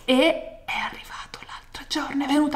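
excited whisper. Real, not acted. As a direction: hush it like a secret. Racconto sussurrato con entusiasmo. (sussurrando con entusiasmo)

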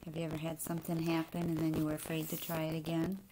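ordinary delivery, nothing marked

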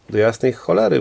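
plain reading voice

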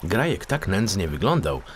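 ordinary delivery, nothing marked

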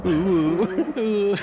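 high mock ooh; imitating ooh. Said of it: "he's going".

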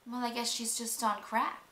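ordinary delivery

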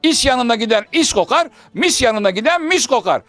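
emphatic Hacivat. Acted. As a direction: drive each item home to Karagöz. Hacivat admonishing.

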